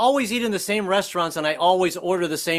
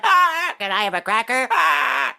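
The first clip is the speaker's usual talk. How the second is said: petulant parrot; 7 semitones higher than usual. Retold or imitated. Husband apologizing to his wife like a petulant parrot. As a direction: squawk it like a sulky parrot. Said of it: "sounding like a petulant parrot".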